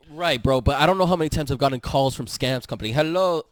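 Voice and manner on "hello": light mock Indian call-center hello; a hint of an Indian accent on the greeting; faint scam-caller accent. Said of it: "I'm not gonna do the accent".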